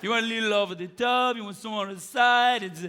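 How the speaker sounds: put-on old barber's comic Italian accent. Old barber greeting his customer, Italian accent. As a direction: sing out the welcome in a thick Italian accent. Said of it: "old Italian barber".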